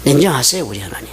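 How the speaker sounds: normal talking voice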